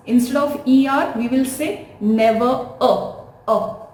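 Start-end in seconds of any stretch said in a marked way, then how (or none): none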